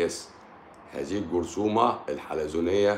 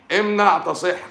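high and loud, a touch quicker than before; shouted slogan; 11 semitones higher, 5 dB louder, slower than usual. Acted. Doctor repeating a mock slogan to viewers, loud and high. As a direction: belt the slogan out once more.

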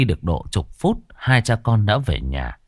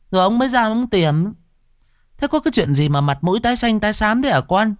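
high-pitched, fast nagging mother voice; complaining mother; 8 semitones higher, faster than usual. A narrator's reading. Mother nagging her grown son about coming home late. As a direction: scold him like a fretting old mother. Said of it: "bà Thu mẹ nó đã than thở".

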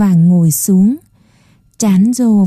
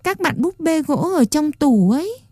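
put-on higher, softer little boy voice; small pleading boy; 6 semitones higher, 6 dB quieter than usual. A narrator's reading. Puppet boy asks the old man to let him stay, softly pleading. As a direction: ask timidly like a small child.

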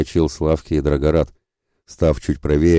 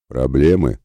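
grim; slower than usual. A narrator's reading. Ратибор sizing up men ready to fight, grim. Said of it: "хмуро спросил он".

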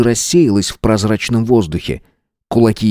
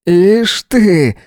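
higher-pitched, mildly mocking jab; mocking smirk; 9 semitones higher, 3 dB louder than usual. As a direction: say it with an audible smirk. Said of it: "усмехнулся Дмитрий".

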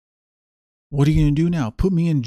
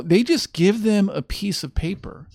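usual speaking voice

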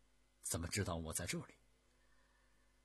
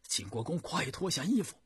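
loud, much higher hurried plea; urgent urging; 9 semitones higher, 9 dB louder than usual. A narrator's reading. Guards urging their lord to change clothes, loud and urgent. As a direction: press him to hurry. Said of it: "连声的催促".

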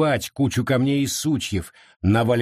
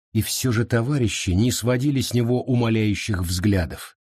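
usual speaking voice

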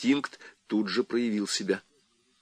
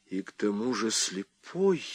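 softer, stunned utterance; barely able to speak; 8 dB quieter than usual. A narrator's reading. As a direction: barely get the words out, hushed. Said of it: "еле смог проговорить Уэймен". (еле выговаривая, тихо)

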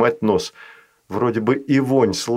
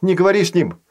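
high urgent cry; shout; 7 semitones higher, much faster than usual. Acted. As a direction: call out to stop him. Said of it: "крикнула Ева".

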